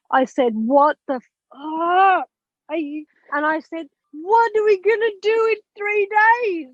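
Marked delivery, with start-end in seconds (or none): 0.5-3.0 slow, stunned swear shouted out; retold shocked shout; shocked outburst. Retelling shock at diagnosis. 4.1-6.7 much higher, desperate plea; retold frantic disbelief; panicked question. Retelling panic at diagnosis.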